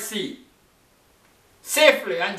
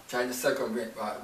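repeated much softer, "Trying" still leaning forward; soft echo; 9 semitones lower, 10 dB quieter than usual. Shown as a demonstration. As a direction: let it fall away, softer the second time. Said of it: "repeated softer".

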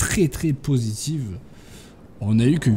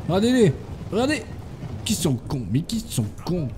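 much higher, exasperated look at them; exasperated disbelief; 5 semitones higher than usual. Real, not acted. Player scolding his clumsy soldiers, high exasperated disbelief.

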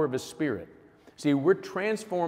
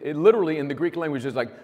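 normal talking voice

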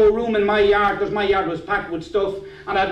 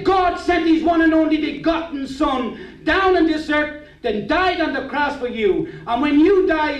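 higher, preaching pastor's voice; pastor's pressing challenge; 7 semitones higher than usual. Retold or imitated. Pastor confronts a man at the meeting, urgent preaching. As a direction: preach it straight at one listener. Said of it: "He said".

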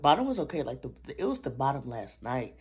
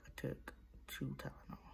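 whisper; 9 dB quieter than usual. Real, not acted. Sore mouth, whispered aside.

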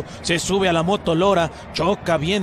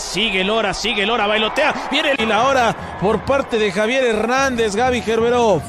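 higher and louder as the play builds; rising excited commentary; 5 semitones higher, 3 dB louder than usual. Real, not acted. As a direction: build up as the player dribbles.

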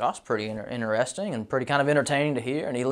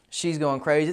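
ordinary talk

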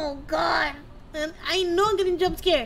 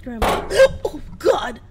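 gasping shock; 2 semitones lower, 7 dB louder than usual. Real, not acted. Gasp after scare.

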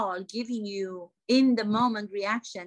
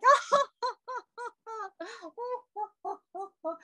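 long, high theatrical laugh; put-on laughter; 12 semitones higher than usual. Actress laughing because she didn't see it coming, put-on laughter. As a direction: burst into showy, high-pitched laughter. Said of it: "I'm laughing".

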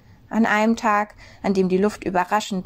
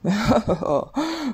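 booming snowman laugh; hearty rumbling snowman laugh; big laugh; 3 semitones higher than usual. A narrator's reading. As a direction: let the snowman roar with laughter. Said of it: "diesmal ganz laut".

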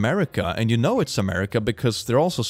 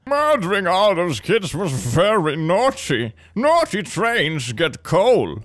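high, very slow mock scolding; scolding Santa; 6 semitones higher, 4 dB louder, slower than usual. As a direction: draw out a hammed-up Santa reproach. Voices Santa scolding a naughty train.

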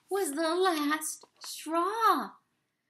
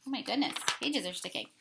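plain reading voice

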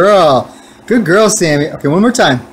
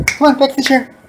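high sing-song coaxing for a cat; pet talk; 12 semitones higher than usual. Real, not acted. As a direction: coax the kitty in a high voice.

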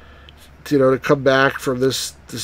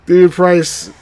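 usual speaking voice

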